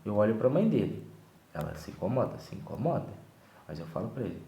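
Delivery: ordinary talk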